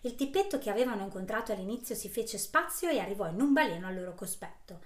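usual speaking voice

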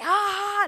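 loud, high mock scream of thrill; a short excited scream on the ride. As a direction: let out a rollercoaster yell.

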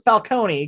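Falcone said fal-KOH-nee; Italian-y pronunciation. Shown as a demonstration. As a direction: Falcone in three syllables, ending "-nee". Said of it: "sounds a little more Italian-y".

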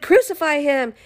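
high-pitched retold crowd cry; mock crowd shout. Crowd insisting to Pilate, mock shout. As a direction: imitate a crowd calling out, half-voiced shout. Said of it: "But they shouted".

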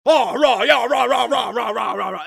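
loud, high jeering rabble, fading; cartoonish parliamentary hubbub; cheering British MPs. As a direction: mimic a braying chamber of supporters. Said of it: "everyone who supports him goes".

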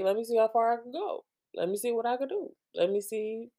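mock kid voice, lifted and testing; boundary-testing child. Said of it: "the kind of child who is like".

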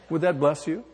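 ordinary talk

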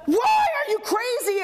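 angry intercession yelled at the Lord, high and slow; exasperated shout. Acted. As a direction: demand it at the top of your voice. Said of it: "there's the".

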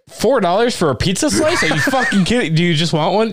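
high, laughing indignant rant; mock outraged customer. Customer ranting at the pizza counter, laughing and outraged. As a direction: scoff at the price through laughter. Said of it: "go to that company and be like".

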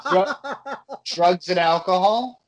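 laughing through the words, slow; giggling as you say it. Real, not acted. Laughing answer about the look.

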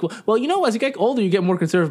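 high-pitched, condescending mimicry; mocked relative. Imitating relatives at a family party, mocking. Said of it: "they're like".

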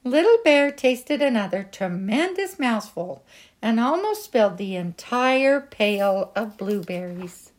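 usual speaking voice

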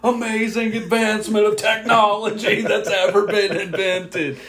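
put-on very high, slow, stretched-out kid voice; awestruck kid. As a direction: gush in wonder like an over-the-top little kid. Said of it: "every eight, nine-year-old kid was just like".

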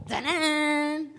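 a loud call of Tanan. Retold or imitated. Neighbour knocking calls the preacher loudly. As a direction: call out the name.

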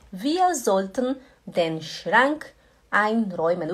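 slow, clear German sentence; careful slow teaching delivery; slow demo. Shown as a demonstration. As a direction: spell it out slowly for students.

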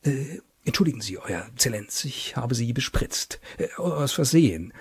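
soft, halting whisper. Acted. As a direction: murmur an apology very softly. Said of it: "flüsterte dem General ins Ohr".

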